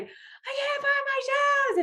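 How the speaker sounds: high, slow, drawn-out shout; frantic kid. Retold or imitated. Coach Carson panicking over his shoes, slow high shout. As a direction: whine it like a panicking boy late for camp. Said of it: "And he's like".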